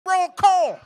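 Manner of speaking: high, loud mocking yell; hammed-up shouted caricature. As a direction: ham up an angry local shouting. Mocking aggressive neighbors.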